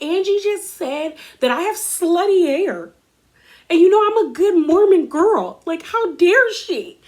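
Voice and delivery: high, whiny imitation of wronged crying; mock tearful. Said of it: "she starts crying".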